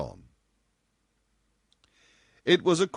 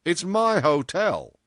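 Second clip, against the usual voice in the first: loud, slow, higher voice; assured boss; 10 semitones higher, 7 dB louder, slower than usual. A narrator's reading. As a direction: brush the objection aside, slowly and firmly. Boss asserts his authority.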